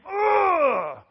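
loud, high exasperated "ugh"; tantrum groan. Acted. Preacher acting out Jonah stomping his feet, tantrum groan. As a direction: let out a foot-stomping yell of frustration. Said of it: "like stomping your feet and saying".

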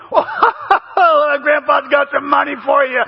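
slow, laughing grandpa voice; doting grandpa. Retold or imitated. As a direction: imitate a grandpa tickled by a joke. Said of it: "this same man becomes a grandfather".

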